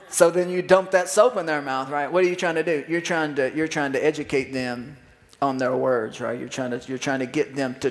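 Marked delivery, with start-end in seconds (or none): none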